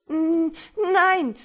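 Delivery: high, loud, stammered whine; whimpering. A narrator's reading. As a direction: whine it out in terror, stuck on the first sound. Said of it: "winselte Frau Kederfiel".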